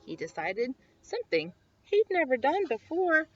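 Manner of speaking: ordinary delivery, nothing marked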